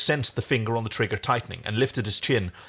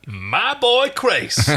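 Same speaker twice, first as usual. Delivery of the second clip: high, loud, slow, stretched out; milk the line for comedy; hammed-up gleeful cry; 12 semitones higher, 6 dB louder, much slower than usual. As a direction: reader hams up Crichton's taunt, high and slow. Reader hamming Crichton's line.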